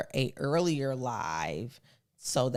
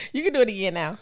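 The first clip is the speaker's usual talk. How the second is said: eager prompt; 7 semitones higher, 5 dB louder, faster than usual. Real, not acted. First offer, before being asked "Huh?.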